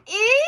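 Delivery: short high loud squeal; high-pitched "ee" shriek. A narrator's reading. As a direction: squeal in sudden shock. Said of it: "the first shriek came from Brianna".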